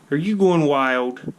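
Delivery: high, slow question to a pet; pet talk. Real, not acted. Teasing his pet squirrel.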